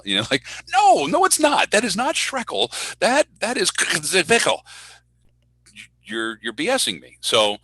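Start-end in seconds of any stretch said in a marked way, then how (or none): 0.7-4.6 very high, squealed mock protest; incredulous protest. Announcer rejecting someone's guess at a name, incredulous. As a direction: object in comic, high-pitched disbelief. Acted protest at wrong reading.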